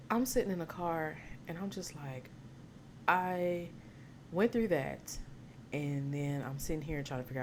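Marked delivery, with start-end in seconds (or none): none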